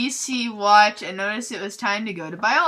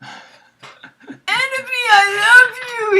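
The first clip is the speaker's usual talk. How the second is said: mock sobbing shout; 13 semitones higher, 8 dB louder than usual. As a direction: wail it like a lovesick emo. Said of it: "he shouted sadly".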